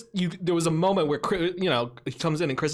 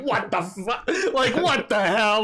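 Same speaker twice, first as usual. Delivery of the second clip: laughing; 7 semitones higher, 4 dB louder, slower than usual. Retold or imitated. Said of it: "everybody in class would be like".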